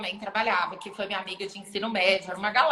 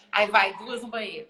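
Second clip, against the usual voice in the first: normal talking voice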